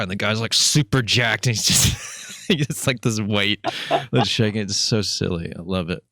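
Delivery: usual speaking voice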